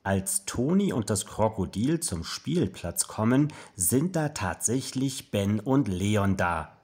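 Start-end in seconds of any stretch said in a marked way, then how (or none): none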